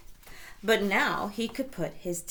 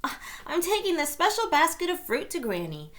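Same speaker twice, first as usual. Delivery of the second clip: put-on quick, higher little-girl voice; high eager child voice; high girl reply; 10 semitones higher, 4 dB louder, faster than usual. A narrator's reading. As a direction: answer brightly like a little girl. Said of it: "Little Red replied".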